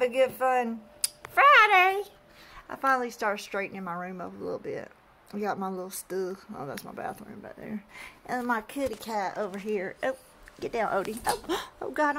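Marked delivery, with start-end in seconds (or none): 1.3-2.0 excited high. Real, not acted. Cheerful sign-off. 11.3-12.2 startled gasp. Real, not acted. Startled by dog.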